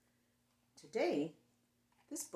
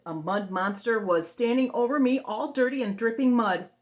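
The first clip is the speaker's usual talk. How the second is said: dramatic build; 3 semitones higher, 11 dB louder than usual. A narrator's reading.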